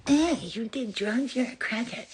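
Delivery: high, slow, sneering whisper; mock judgmental. Mocking people who call addicts crackheads, sneering. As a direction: mimic the judging crowd. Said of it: "so many people are like".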